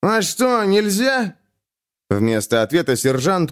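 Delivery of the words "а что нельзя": cheeky. A narrator's reading. Drunk thief cheeky reply.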